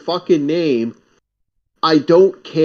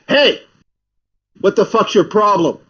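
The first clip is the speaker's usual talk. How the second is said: raised, louder mock challenge; confrontational muscle guy; 4 semitones higher, 3 dB louder than usual. Muscle-guy friend confronts landlady's son in bar, mock aggressive. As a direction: imitate a big friend squaring up. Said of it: "my friend's a big muscle guy".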